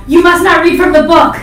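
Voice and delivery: loud, high-pitched dramatic warning; mock yell. Co-host demonstrating what they'd yell at readers in Egypt, yelling. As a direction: yell it out like a hammed-up command. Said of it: "I'm gonna yell".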